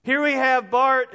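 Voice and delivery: normal talking voice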